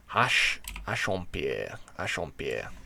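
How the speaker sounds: careful French "hache en pierre" with an American accent; French pronunciation attempt. Shown as a demonstration. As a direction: sound out "hache en pierre" in French, deliberately and slowly. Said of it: "I really gotta work on my French accent".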